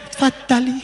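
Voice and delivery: high, soft, shy offer; timid bride. Acted. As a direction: barely dare to speak.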